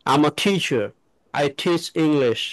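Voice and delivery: very slow, t puffed; slow model sentence. Shown as a demonstration. Teacher models a slow sentence with aspirated t. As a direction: stretch teacher and teach with a breathy t. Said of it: "Mm-hmm".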